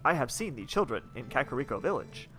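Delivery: stilted robot delivery with bobbing pitch; robot man. Acted. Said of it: "you're like a robot man".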